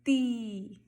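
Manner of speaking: slow TI with a clean T, no "chi"; hard T. Shown as a demonstration. Teacher modelling T before I, hard "ti". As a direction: say ti with tongue on teeth, stretched. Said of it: "você vai falar".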